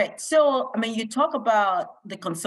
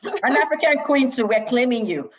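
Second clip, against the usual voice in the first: playful claim; 6 semitones higher, 4 dB louder than usual. Real, not acted. Host playfully claims guest.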